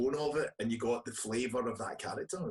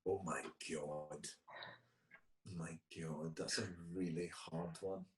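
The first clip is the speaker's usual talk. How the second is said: low, hushed dismay at a tough choice; stumped; 6 semitones lower, 11 dB quieter than usual. Real, not acted. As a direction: murmur it slowly to yourself, stumped. Stumped by a hard question.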